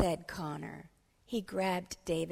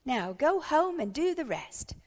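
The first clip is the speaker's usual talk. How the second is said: raised, lilting teacher's send-off; excited cry; 7 semitones higher, 6 dB louder than usual. Acted. Teacher sends her pupils home, excited cry. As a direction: call it out to the pupils. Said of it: "cried Missus Ruler".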